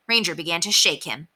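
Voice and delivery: ordinary delivery, nothing marked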